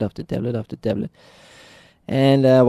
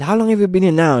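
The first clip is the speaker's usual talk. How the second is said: energetic question; 8 semitones higher than usual. Retold or imitated. Waking up full of energy.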